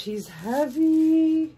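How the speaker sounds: high, loud, drawn-out amazement; astonished at the weight; surprised exclamation. Real, not acted. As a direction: stretch it out loud in delighted surprise.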